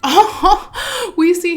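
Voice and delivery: high, loud laughing burst. Real, not acted. Gamer laughing at a bad rating, laughing burst.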